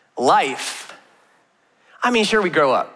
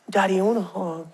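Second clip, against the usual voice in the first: put-on soft little-boy voice asking for a hug; quiet, sweet small child asking for a hug; sweet toddler ask; 5 dB quieter than usual. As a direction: coax daddy like a cuddly four-year-old. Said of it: "Austin has this way of being like".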